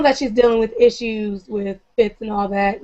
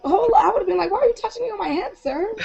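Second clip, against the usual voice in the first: high-pitched, affronted questioning; mock indignation; 10 semitones higher, faster than usual. Imagining herself as the boy patted on the head, mock indignant. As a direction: protest in a much higher voice, half laughing.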